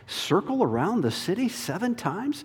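high, disbelieving scoff; incredulous scoffer. Acted. Doubting voice mocking Joshua's orders, high and incredulous. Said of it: "The temptation facing Joshua".

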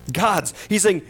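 usual speaking voice

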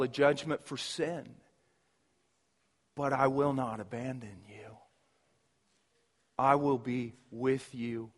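a gentle promise, almost hushed; tender voice of God. Acted. God reassuring his exiled people, soft and tender.

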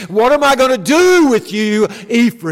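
God despairing over his people; divine exasperation. Acted. Preacher voicing God crying out to Israel in exasperation. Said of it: "in exasperation, looks upon these people and says".

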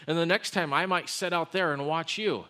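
ordinary talk

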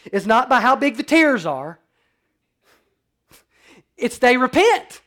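loud, high, fervent sermon voice; preaching at full force. Acted. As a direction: lift it up and drive it home to the congregation.

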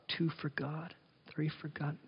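under the breath, tallying each seed; mock muttered counting. Preacher mimicking a Pharisee counting cumin seeds, muttering. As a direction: softly count seeds like a fussy miser.

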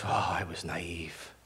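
soft, low mock regret; hushed self-reproach, played for laughs; rueful realization. Acts out own dawning regret.

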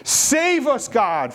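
high, loud crowd cry; shouting out. Acted. Preacher voices the crowd pleading with God, shouting out. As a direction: cry out for rescue at full voice. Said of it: "the people are shouting out".

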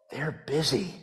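put-on very soft, small child's voice; little boy. A four-year-old answers the pastor, very soft.

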